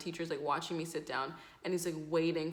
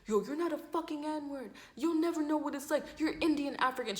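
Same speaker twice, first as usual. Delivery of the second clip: high-pitched imitation of an angry guy; heated argument; 8 semitones higher than usual. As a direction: argue back insistently, pitched up. Acted guy in yelling match.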